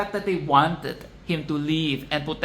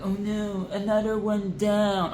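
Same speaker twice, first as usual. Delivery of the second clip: lightly voiced fretting queen; a hint of a prissy queen's worry; mock royal fret; 4 semitones higher than usual. Mocking the queen as she watches ants collapse, lightly fretful. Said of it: "Queen and Queen to Be is like".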